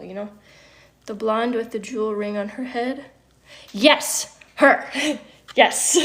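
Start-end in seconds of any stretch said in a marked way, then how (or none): 3.7-6.0 loud eager confirmation; excited yes. Real, not acted. Vlogger answering chat's guess, loud and excited. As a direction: jump on it with delight.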